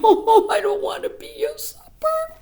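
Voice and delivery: mock tearful little girl; Pearl crying. A narrator's reading. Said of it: "Pearl was crying".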